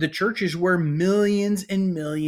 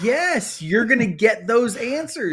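much higher, insistent yes; emphatic yes; 7 semitones higher than usual. Real, not acted. To co-host who says no, an insistent high yes. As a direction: overrule him with a rising, emphatic yes. Insisting against co-host's denial.

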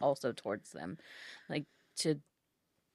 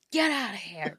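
a half-hearted mock yell; 5 semitones higher, 7 dB louder than usual. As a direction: shoo it off, not truly yelling. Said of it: "But I'm not gonna actually yell".

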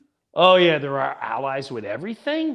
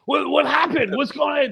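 high, flustered panic; mock alarm; 11 semitones higher, 3 dB louder than usual. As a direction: react as if you're missing a disaster. Acts startled listener reacting to news.

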